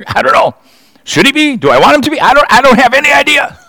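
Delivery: high, fast, baffled rambling; preacher voicing a confused listener, mock baffled; mock bewilderment. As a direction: play up being hopelessly confused. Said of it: "mock bewilderment".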